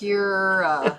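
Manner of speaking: "Pura" stretched out very slowly and deliberately; slow careful Pura. Shown as a demonstration. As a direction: spell out "Pura" slowly, sound by sound. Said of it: "it's Pura".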